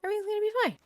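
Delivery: very high-pitched, unconvinced insistence; squeaky self-reassurance. Real, not acted. Player convincing self about exiling Patty, squeaky reassurance. Repeats reassurance higher each time.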